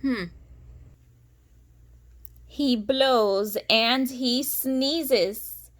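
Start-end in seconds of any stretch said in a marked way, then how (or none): 2.5-5.7 lilting storybook read-aloud; sing-song rhyming narration; reading to children. A narrator's reading. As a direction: read it like a bedtime picture book. Reading picture book aloud.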